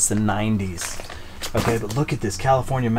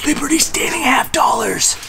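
hushed, breathy whisper of wonder; awed whisper; 12 semitones higher than usual. Real, not acted. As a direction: whisper as if afraid to break the spell. Awed by more coins.

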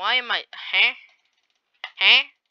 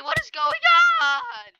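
drawn-out wail; 6 dB louder, much slower than usual. Real, not acted. Confused panic in lag.

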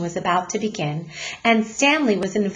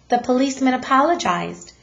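usual speaking voice

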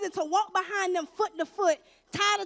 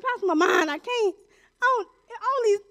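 higher-pitched, halting imitation of crying; mock tearful plea; 3 semitones higher, 3 dB louder than usual. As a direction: stammer it out like a retold boo-hoo. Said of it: "I'm crying".

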